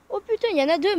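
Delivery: sudden loud surprised cry; startled exclamation. Real, not acted. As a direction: blurt it out, caught off guard. Second train suddenly appears.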